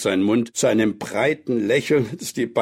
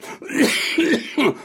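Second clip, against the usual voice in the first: put-on old man's renewed hacking cough; acted coughing fit. A narrator's reading. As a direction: cough hard, cutting the speech off. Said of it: "Ein neuer Hustenanfall". (gespielter heftiger Hustenanfall)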